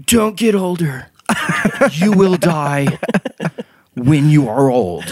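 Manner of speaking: high, slow ominous proclamation; hammed-up solemn warning; dramatic time-travel warning. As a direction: warn them slowly, like a messenger from the future.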